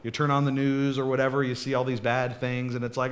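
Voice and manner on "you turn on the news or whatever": usual speaking voice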